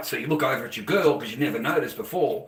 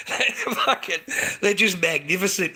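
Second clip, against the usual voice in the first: laughing; 6 semitones higher than usual. Real, not acted. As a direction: let the laugh spill into it. Laughs about her looks.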